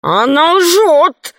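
high, drawn-out panicked wail; frightened yell. A narrator's reading. Accused count denies the girl's charge, frightened yell. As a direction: cry out in alarm, high and stretched. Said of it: "испуганно завопил граф".

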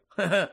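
short forced-out laugh; quick hearty chuckle in character; short guffaw. A narrator's reading. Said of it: "І він коротко реготнув".

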